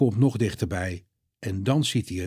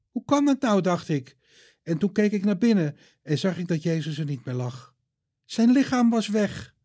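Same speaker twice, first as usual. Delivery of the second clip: put-on higher, earnest young woman; Maria's voice; 6 semitones higher than usual. Maria tells Peter and John, higher earnest voice. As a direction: voice Maria, upset and eager.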